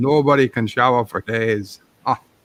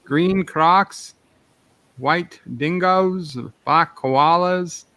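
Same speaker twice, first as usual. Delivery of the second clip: slow, pitched-up listing of charms; slow drawn-out list; 5 semitones higher, much slower than usual. Acted. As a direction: dwell on each item like a cereal ad.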